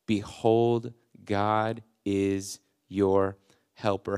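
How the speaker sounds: God is your helper said very slowly; a firm promise to the listener; slow declaration. Acted. Repeats verse to listeners.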